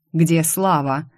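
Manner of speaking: usual speaking voice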